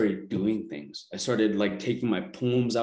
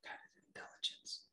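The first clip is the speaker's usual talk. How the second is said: faint breathy whisper voicing an inner voice; barely-there whisper, as if from within; 24 dB quieter than usual. She retells the whisper that came to her, hushed whisper. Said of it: "the whisper came to me and said".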